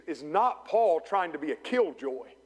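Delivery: ordinary delivery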